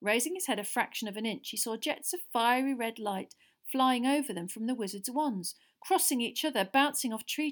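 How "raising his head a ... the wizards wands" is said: ordinary delivery, nothing marked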